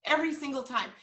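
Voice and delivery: ordinary talk, nothing marked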